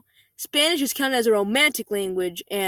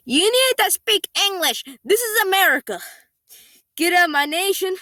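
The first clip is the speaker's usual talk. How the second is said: higher and louder, shrieking demand; mock screaming mother; 8 semitones higher, 4 dB louder than usual. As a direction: shriek it like an outraged entitled mother. Quoting entitled mother's rant.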